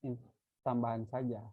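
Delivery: ordinary talk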